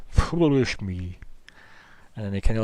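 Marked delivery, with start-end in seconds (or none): 0.1-1.2 slow, drawn-out chuckling words; silly self-mockery. Creature laughing at his own silly question, slow. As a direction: laugh at yourself in his watery voice. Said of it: "laughs at himself a bit, like, silly, goes".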